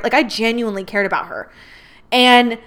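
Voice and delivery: ordinary talk, nothing marked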